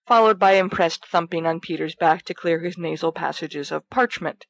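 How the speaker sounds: ordinary talk, nothing marked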